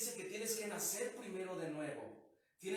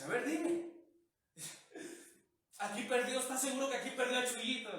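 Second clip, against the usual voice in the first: put-on drunk man; 4 semitones higher, 4 dB louder than usual.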